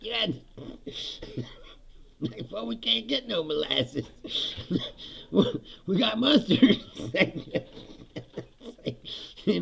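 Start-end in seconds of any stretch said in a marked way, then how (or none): 2.5-4.1 mock Southerner. Voicing blockade-era Southerners. 5.3-6.8 mock Southerner. Voicing blockade-era Southerners.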